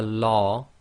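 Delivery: "law" with the long back vowel of large; heavy "law" sound. Shown as a demonstration. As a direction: draw out "law" with a full mouth. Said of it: "compare this to the word large".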